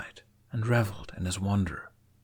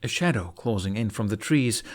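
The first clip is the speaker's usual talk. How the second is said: usual speaking voice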